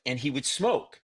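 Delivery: usual speaking voice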